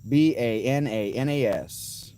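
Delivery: B A N A N A S, each letter slow and punched; playful slow spelling; spelled out slowly. Real, not acted. Spelling out bananas.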